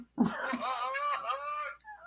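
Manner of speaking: high, loud mocking laugh; hammed-up dopey laugh. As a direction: cackle like a mocked fool. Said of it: "when you do start acting like that".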